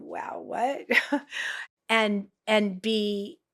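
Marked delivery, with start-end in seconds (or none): 0.0-1.2 stunned whisper. Retold or imitated.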